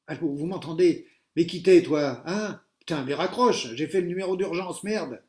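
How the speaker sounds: very loud cursing shouts; furious phone shouting. A narrator's reading. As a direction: yell into the phone. Angry pupils on phones.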